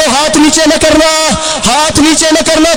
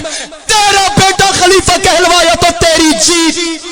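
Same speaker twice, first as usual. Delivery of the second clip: climactic shout; slower than usual. Real, not acted. Preacher shouting the closing verdict to the crowd. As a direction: bellow the final verdict.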